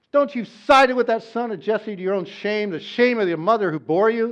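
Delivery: loud, scornful rebuke; furious king. Acted. A king scolding his son before his leaders, loud and scornful. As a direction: lash out at a disloyal son. Saul berating Jonathan at table.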